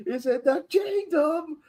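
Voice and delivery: high, very slow, stretched out mock sermon; mock preacher. Friend mimicking the preacher's proclamation, drawn out. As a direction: drag it out like a street preacher. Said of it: "re-enacts preacher's line".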